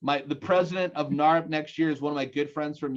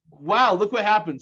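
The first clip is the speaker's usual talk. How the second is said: surprised exclamation; 5 semitones higher, 6 dB louder than usual. Real, not acted. Surprise at the audience response.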